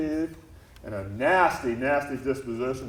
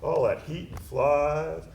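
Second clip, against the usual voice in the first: usual speaking voice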